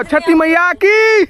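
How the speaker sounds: high, slow, drawn-out devotional chant call; jaikara call. Real, not acted. Vlogger leads workers digging clay in a loud, drawn-out chant. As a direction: lead the crowd in a rising cheer to Chhathi Maiya. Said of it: "एक बार सब जयकारा लगाओ".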